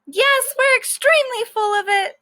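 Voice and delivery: very high squeaky critter voice; gleeful guinea pig. Acted. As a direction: pile on happily as the second pet. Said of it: "says Moo".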